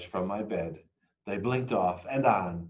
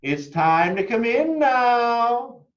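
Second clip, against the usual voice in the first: much higher, loud, slow call from the house; parent calling in; 13 semitones higher, 7 dB louder, slower than usual. A narrator's reading. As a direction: call the kids home from the porch. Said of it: "someone called from my house".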